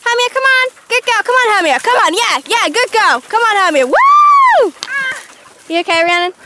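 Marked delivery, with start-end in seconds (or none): 0.0-3.9 high coaxing call to a dog. Real, not acted. Calling dog out of tree. 3.9-4.7 high excited whoop; cheer. Real, not acted. Dog comes free.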